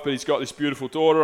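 ordinary talk

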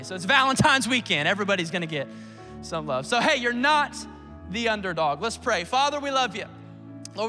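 usual speaking voice

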